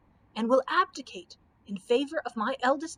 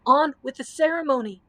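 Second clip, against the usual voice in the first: loud, urgent call; commanding cry; 3 semitones higher, 7 dB louder than usual. Acted. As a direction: push the ceremony on. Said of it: "cried the eldest prince".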